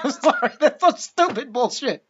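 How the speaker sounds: laughing through the words, high-pitched. Real, not acted. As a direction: crack up in disbelief while talking. Cracks up at the plot's absurdity.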